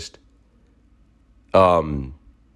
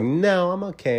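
much higher, lighter voice turning him down; mock girl's brush-off; 10 semitones higher, 3 dB quieter than usual. Girl at the club declining a guy's advances, high mock brush-off. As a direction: play the girl politely saying no thanks.

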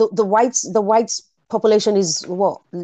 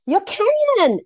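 high, surprised exclamation; sudden realisation; 13 semitones higher, slower than usual. Real, not acted. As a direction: blurt out the realisation. Realising her mistake.

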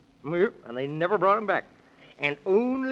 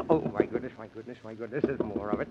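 much lower and softer fretting; hushed dismay; 11 semitones lower, 7 dB quieter, faster than usual. Acted. Man in the Moon hears another knock, hushed dismay. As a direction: despair at more trouble.

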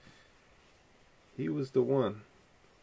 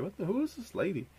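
put-on confused kid; 10 semitones higher, 4 dB quieter than usual. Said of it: "You're like".

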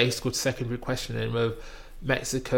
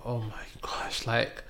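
slow, soft whispered gasp; shocked whisper; 7 dB quieter, slower than usual. Retold or imitated. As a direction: whisper it in disbelief. Shocked aside while watching.

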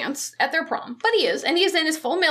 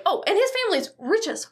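ordinary talk, nothing marked

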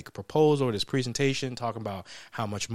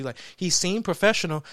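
usual speaking voice

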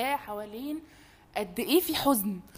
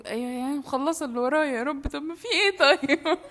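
exasperated plea; 5 semitones higher, 4 dB louder than usual. Retold or imitated. Mock exasperated plea to God.

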